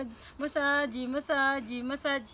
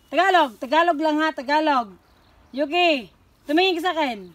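loud command; 2 semitones higher, 11 dB louder, much faster than usual. Real, not acted.

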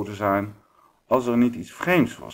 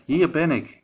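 calling voice; 7 semitones higher, 3 dB louder than usual. A narrator's reading. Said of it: "steeds kwam de stem met".

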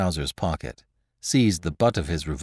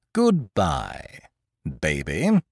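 patronizing; 4 semitones lower, much slower than usual. Acted. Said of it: "in a patronizing tone".

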